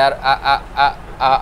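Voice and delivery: a low, stuck stammer on আ, আ, আ; stammering demo. Shown as a demonstration. Teacher demonstrating an angry patient's stammer to students. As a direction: show how the words catch in anger. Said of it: "কথা বেঁধে বেঁধে যায়".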